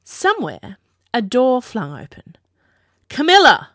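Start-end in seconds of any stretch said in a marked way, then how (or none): 3.1-3.7 loud, high furious shout of a name; angry call. A narrator's reading. An angry king calls his daughter from the doorway, shouting. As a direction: bellow her name through the door. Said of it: "called an angry voice".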